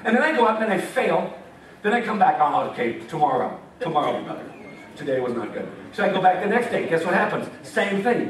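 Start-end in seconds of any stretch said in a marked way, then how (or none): 2.4-5.7 low, deflated self-talk, sagging at the end; talking yourself down after failing again; discouraged sigh. Retold or imitated. Preacher imitating his own defeated inner voice, low and deflated.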